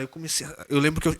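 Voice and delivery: normal talking voice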